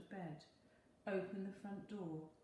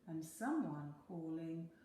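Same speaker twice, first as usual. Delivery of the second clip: plain reading voice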